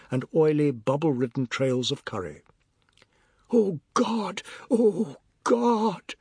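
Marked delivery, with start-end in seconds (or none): 3.5-6.1 high cry rising towards bawling; wailing cry. A narrator's reading. Boy covered with food from above, wailing cry. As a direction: wail in outraged misery. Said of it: "began as a gentle cry and rose rapidly".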